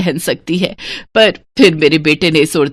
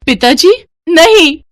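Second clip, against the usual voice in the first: high, alarmed cry of protest; acted shock rising to a desperate cry; horrified cry; 9 semitones higher, 4 dB louder, slower than usual. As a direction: cry out as if facing destruction. Witch being destroyed by her father.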